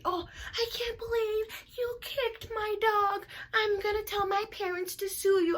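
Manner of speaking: very high whiny brat voice; hammed-up spoiled whine; mocking the girl. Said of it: "she's like".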